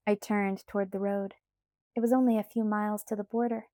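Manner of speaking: ordinary delivery, nothing marked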